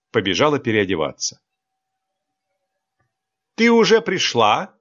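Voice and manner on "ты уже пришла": high, louder call across the room. A narrator's reading. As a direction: call out to her from afar. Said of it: "окликнула её Алекс". (окликнула, громко и высоко)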